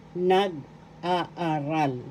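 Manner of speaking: "nag-aaral" stretched very slowly, long double a; slow teaching. Shown as a demonstration. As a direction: draw it out for the class.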